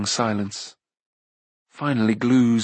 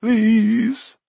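high, rising plea; high-pitched wheedling; pleading Glue; 11 semitones higher, 5 dB louder than usual. A narrator's reading. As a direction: beg in a small whine. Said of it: "said Glue faintly".

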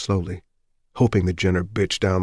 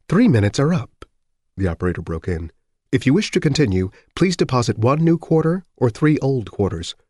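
operator voice; 6 semitones higher than usual. Acted. Telephone operator interrupting.

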